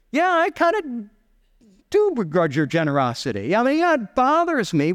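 high-pitched, hesitant grudging admission; reluctant resentment. Acted. Listeners admitting they begrudge the master's generosity, acted grudging. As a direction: own up to it haltingly, mock-aggrieved.

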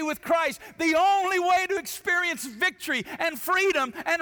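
high, loud, driving sermon climax; full-force preaching. Acted. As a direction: preach it high and hard, building to a shout.

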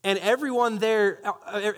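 ordinary talk, nothing marked

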